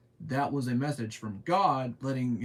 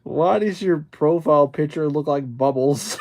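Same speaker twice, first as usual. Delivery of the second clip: loud amused question; 3 semitones higher, 13 dB louder than usual. Real, not acted. Reading a comment while laughing.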